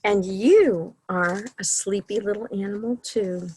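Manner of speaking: lively, sing-song picture-book reading; reading to children. A narrator's reading. Librarian reading a bedtime story to children, sing-song. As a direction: read the bedtime book to the little ones.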